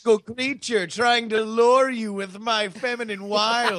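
high playful seductress voice; lightly voiced siren; siren impression. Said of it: "it's my siren impression".

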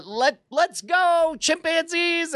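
hammed-up battle call; rallying cry. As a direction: rouse the chimp army to move out. Leader rallies chimps to leave.

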